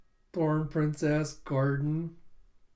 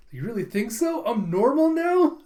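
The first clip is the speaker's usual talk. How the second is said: high question building louder; delighted acted surprise; 9 semitones higher, 7 dB louder than usual. Yor hears coworkers call her normal, delighted. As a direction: rise into an overjoyed, high-pitched question.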